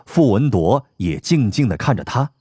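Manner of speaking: usual speaking voice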